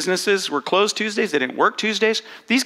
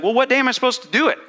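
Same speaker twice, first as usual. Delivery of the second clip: higher, quicker questioner; a listener's eager question, pitched up and fast; an audience member asks, higher and quick; imagined questioner; 7 semitones higher, faster than usual. Retold or imitated. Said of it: "you're gonna ask me".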